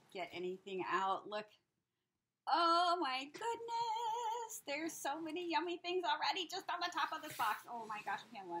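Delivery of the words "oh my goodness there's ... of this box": high, loud gush, opening drawn right out; excited. Real, not acted. Crafter seeing a box of gifts, excited. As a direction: squeal it out in real delight. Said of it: "I'm so excited".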